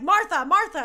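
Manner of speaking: loud, high, hammed-up cry of a name; movie-parody shout; mock dramatic yell. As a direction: belt the name like a movie climax. Mock Batman v Superman moment.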